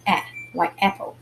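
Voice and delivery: a said short as in apple; short A sound. Shown as a demonstration. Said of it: "it has a short sound".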